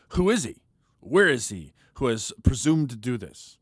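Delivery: a king indignantly demanding who. Acted. A king demanding to know who threatened his queen, indignant.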